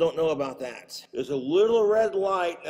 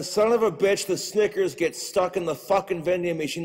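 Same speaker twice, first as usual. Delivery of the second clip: usual speaking voice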